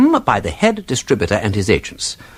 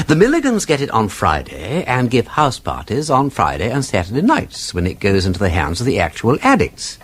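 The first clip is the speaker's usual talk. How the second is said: usual speaking voice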